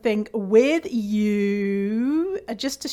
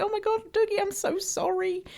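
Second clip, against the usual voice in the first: high, soft apologetic coo; soothing the dog; 10 semitones higher, 5 dB quieter than usual. Retold or imitated. Owner cooing sorry to her scared dog, high and soft. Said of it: "And I was like".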